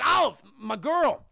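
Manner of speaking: loud, slow, drawn-out hail; effusive greeting. Retold or imitated. Grateful penguin turns to Olive, effusive greeting. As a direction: hail your favorite accomplice.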